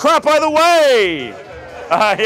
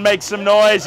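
normal talking voice

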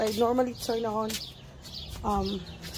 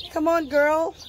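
high, louder coaxing call; calling a pet; 6 semitones higher, 6 dB louder, slower than usual. Real, not acted. Owner calling Henny over, high coaxing call. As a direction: coax the dog to come, up high.

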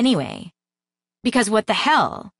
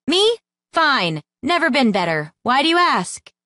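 higher, louder defensive yell; 7 semitones higher, 3 dB louder than usual. A narrator's reading. Sam yells at her worried friends, defensive. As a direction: Sam yells her denial, pitch jumping up. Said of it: "She had no idea why she was yelling".

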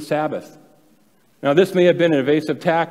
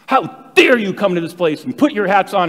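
indignant scolding; 6 semitones higher, 6 dB louder than usual. Retold or imitated. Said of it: "this lady let them have it".

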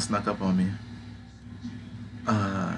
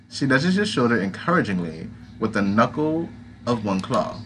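ordinary talk, nothing marked

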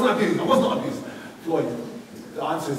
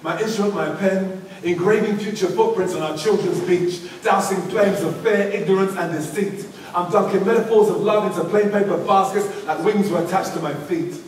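rhythmic, measured poem delivery; poem recital; 3 semitones higher, 4 dB louder than usual. Acted. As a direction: recite it like spoken word on stage. Recites his poem to a school audience.

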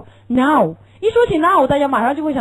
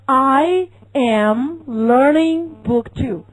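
slow, deliberate "I am learning book two"; slow model answer; 4 dB louder, much slower than usual. Pretend. Teacher acting the student's answer to a friend, slowly. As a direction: spell the sentence out word by word for students. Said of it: "你会说".

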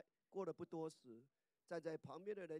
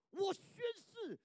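swearing loudly; 13 semitones higher, 11 dB louder than usual. Retold or imitated. Preacher acts Peter swearing he never met Jesus, high and loud. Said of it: "他说".

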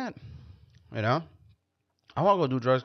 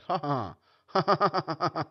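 mock stoned laughter; 5 semitones higher than usual. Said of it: "you're like".

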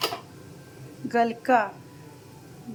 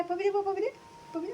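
higher and softer, pleading; coaxing for a kiss; 9 semitones higher, 4 dB quieter than usual. Real, not acted. Mother softly begs the parrot for a kiss, high and sweet. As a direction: sweetly beg the bird for a kiss.

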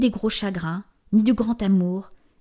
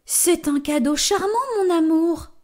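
lightly voiced giantess, grateful; a touch of a pleased giant wife; 8 semitones higher than usual. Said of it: "remercie la géante". (voix de géante ravie)

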